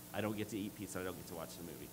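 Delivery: put-on a dejected boy answering his dad, softly and a little teary; teary little son. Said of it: "he had little tears coming down his face".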